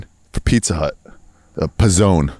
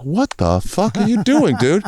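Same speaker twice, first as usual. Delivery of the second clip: slow, higher baffled complaint; baffled partner; 8 semitones higher, slower than usual. Retold or imitated. Audition partner baffled at the improv, slow. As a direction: drag it out in disbelief. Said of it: "the other guy's like".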